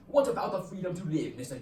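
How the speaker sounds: put-on British accent. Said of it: "hard to say in a British accent".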